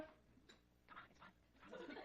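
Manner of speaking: unvoiced, whispered encouragement; whispered coaxing. Real, not acted. Teacher coaxing a shy student, whispered. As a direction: coax them along in a whisper.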